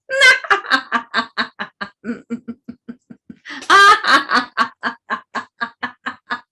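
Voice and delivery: long, loud refusing laughter; protecting laugh. Shown as a demonstration. Class doing the protect-your-heart laugh, long and loud. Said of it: "So let's try that one".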